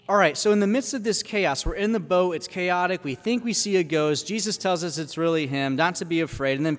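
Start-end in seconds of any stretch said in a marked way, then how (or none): none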